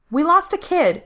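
high, loud, urgent retort; snapped back. A narrator's reading. Diana tells Frank about the lost kid, snapped back. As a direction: fire it straight back, pitch jumping up. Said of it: "she snapped back".

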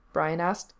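ordinary delivery

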